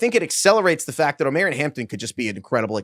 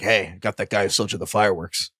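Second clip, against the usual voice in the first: low mock voice; 7 semitones lower, 4 dB quieter than usual. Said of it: "He's just like".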